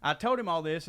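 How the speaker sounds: normal talking voice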